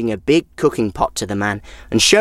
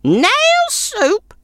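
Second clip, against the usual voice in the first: put-on much higher, scoffing old woman; old woman mocking the stranger's claim; scornful snort. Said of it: "snorted the old woman".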